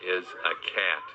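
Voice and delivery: ordinary talk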